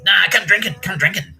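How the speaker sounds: loud, high, insistent come drinking; pushy invite. Retold or imitated. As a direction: badger him to come out drinking. Russell pushing him to drink.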